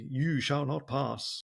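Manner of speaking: slow, grand wizard intoning; Gandalf impression. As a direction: draw it out like Gandalf at the bridge. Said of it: "Gandalf in Lord of the Rings".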